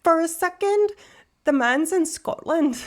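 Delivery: put-on meant-as-Scottish line that lands in an Irish accent. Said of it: "I think that's an Irish accent".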